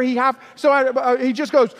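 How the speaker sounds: ordinary talk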